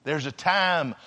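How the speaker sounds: low, very soft voice with heavy pauses; hushed preaching. Real, not acted. Preacher describing someone weeping beside you, hushed preaching. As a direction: let it sink almost to nothing, solemnly.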